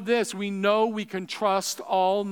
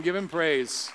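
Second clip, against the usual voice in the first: ordinary talk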